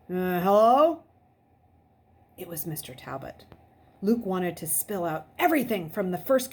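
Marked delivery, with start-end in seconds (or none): 0.1-1.0 sleepy man. A narrator's reading. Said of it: "just as sleepy as the woman's".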